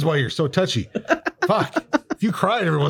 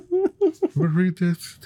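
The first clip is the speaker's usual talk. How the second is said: laughing; 13 semitones higher, slower than usual. Real, not acted. Laughing while introducing the reading.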